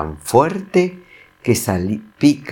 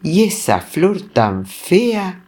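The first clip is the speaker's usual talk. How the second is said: high, slow indignant lion; angry lion; 9 semitones higher, slower than usual. Acted. Lion growing furious at Serafín's ugly flower. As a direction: spit out the outrage, drawn out and high. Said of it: "el león se enfurece".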